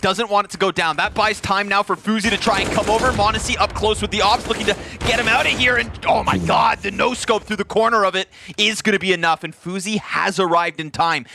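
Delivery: excited caster, pitch held high; live play-by-play. Real, not acted. Caster follows a tense retake attempt, urgent play-by-play. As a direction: keep the call rolling urgently to the missed shot.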